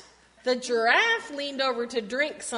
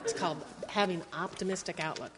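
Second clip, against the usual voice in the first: usual speaking voice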